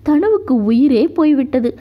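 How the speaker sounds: usual speaking voice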